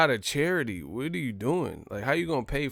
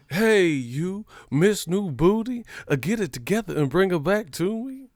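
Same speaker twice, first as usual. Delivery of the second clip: mock address; 7 semitones higher, 4 dB louder than usual.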